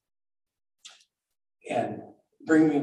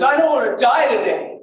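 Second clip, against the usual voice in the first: high, loud desperate cry; screamed plea; 10 semitones higher, 5 dB louder than usual. Retold or imitated. Capsized boater screaming while clinging to the boat, loud high cry. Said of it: "second thing I screamed is".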